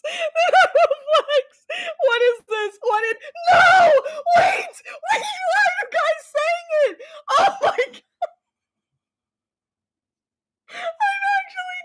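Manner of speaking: high, shrieking laughter through the words; real laughing fit, near tears; hysterical laughing. Real, not acted. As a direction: lose it completely, crying with laughter. Chat floods with flex, hysterical.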